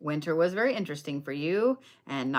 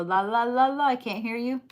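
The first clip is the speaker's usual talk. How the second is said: put-on childish sing-song taunt, rising higher at the end; mock la-la-la; 5 semitones higher than usual. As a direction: pretend you're plugging your ears and drowning them out. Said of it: "plugging your ears".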